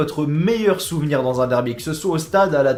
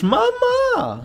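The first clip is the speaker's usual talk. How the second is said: cri de joie. Real, not acted. Découvre les boissons du minibar.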